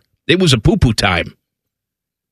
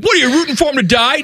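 mock indignant critic; 10 semitones higher, faster than usual.

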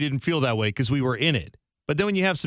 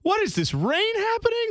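rising higher into an incredulous squeak; mock clueless; 4 semitones higher than usual. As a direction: play dumb about the obvious rain. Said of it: "It's like".

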